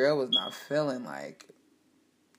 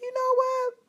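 much higher, louder defiant outburst; fired-up retort; 5 dB louder than usual. Retold or imitated. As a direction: snap back defiantly. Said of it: "I was like".

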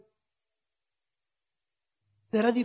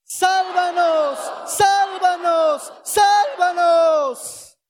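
three long drawn-out shouts, higher and louder; 5 semitones higher, 5 dB louder, much slower than usual. Shown as a demonstration. Preacher and church shout for salvation three times in a row. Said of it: "tres veces seguidas".

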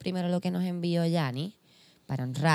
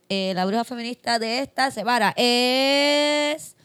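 announcer; 3 semitones higher, 3 dB louder than usual. Acted. Announcing the winner.

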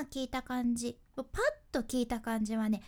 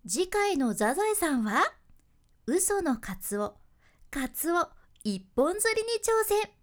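loud, bright TV preview read; anime preview narration; 5 semitones higher, 5 dB louder than usual. Shown as a demonstration. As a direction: announce next week's episode cheerfully. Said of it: "アニメのザザエさんの予告ナレーション".

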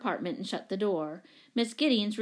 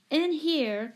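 raised-pitch call from a doorway; 7 semitones higher than usual. A narrator's reading. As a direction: call them over from a distance. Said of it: "called Mother from the doorway".

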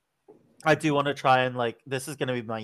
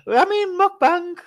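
high teasing; 6 dB louder, slower than usual. Acted. Teasing the hated word.

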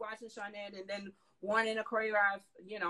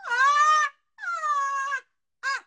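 stammering gasp; 11 dB louder, much slower than usual. Retold or imitated. Starstruck choking on the lines.

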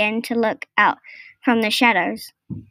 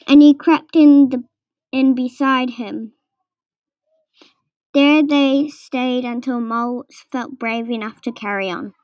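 ordinary delivery, nothing marked

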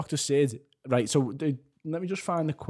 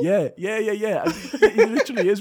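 laughing; 12 semitones higher, 6 dB louder than usual. Real, not acted. Laughing at the Liverpool joke.

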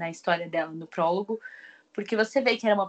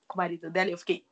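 ordinary talk

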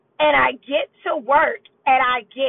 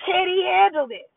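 drill-sergeant dad; 7 semitones higher than usual. Retold or imitated. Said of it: "this whole general thing".

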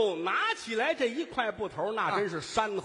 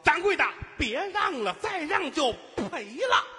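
demonstrated helper's cry; 7 semitones higher than usual. Shown as a demonstration. Lead vendor shows the helper his line, pleading call. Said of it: "你就跟着喊".

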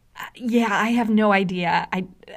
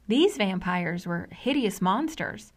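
ordinary talk, nothing marked

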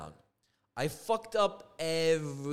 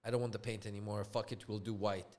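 low, soft, defeated; sad, disappointed; 6 semitones lower, 8 dB quieter, faster than usual. Retold or imitated. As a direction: give up quietly, imitating her disappointment. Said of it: "She was so sad".

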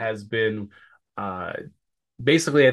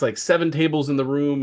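normal talking voice

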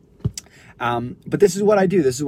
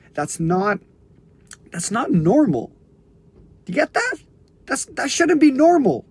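raised, high-pitched, emphatic urging; impassioned insistence; 6 semitones higher, 4 dB louder than usual. Real, not acted. Coach jolting listeners out of self-hatred, impassioned. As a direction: drive each phrase home forcefully, as if shaking them awake.

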